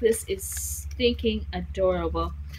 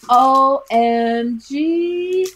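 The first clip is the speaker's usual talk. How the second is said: loud, higher, very drawn-out letters; amazed delight; 4 semitones higher, 9 dB louder, much slower than usual. Real, not acted. Seeing what her friend sent, loud amazed delight. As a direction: stretch each letter out in amazement. Reacts to the handmade gift.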